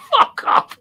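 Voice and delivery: very high, loud refusal; retold outburst. Man retelling his refusal to go near it, high yelped shout. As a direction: yelp it loudly as a retold refusal.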